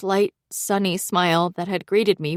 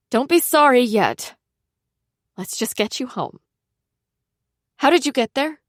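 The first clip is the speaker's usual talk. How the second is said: brisk and worried; 6 semitones higher, 3 dB louder than usual. Acted. Zoe taking charge, worried.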